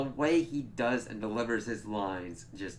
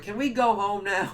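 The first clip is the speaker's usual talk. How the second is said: mock whiny plea; 9 semitones higher, 5 dB louder than usual. Colin voiced, whiny.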